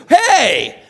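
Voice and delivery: sharp, high, loud "Hey!"; retold shout. Pastor replaying his shout at a driver who cut him off, high and loud. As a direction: yell it at the car that cut you off. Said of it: "like that".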